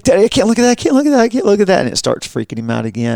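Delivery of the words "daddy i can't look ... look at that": put-on high, pleading child's voice; high-pitched kid begging dad; scared kid. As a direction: beg like a frightened boy. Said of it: "he's like".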